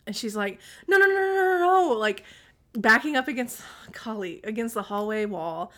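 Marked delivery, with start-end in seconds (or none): 0.9-2.0 imitating a panicked kid, high and loud; loud high rapid-fire refusal, retold; panicked pleading. Child backs against the wall from mom, mock panic.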